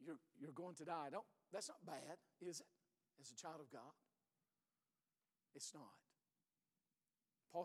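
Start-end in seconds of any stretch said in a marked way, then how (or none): 0.0-6.0 softer, intimate sermon voice; quiet pastoral reassurance; hushed, gentle. Acted. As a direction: pull the room close, quietly. Soft pastoral aside.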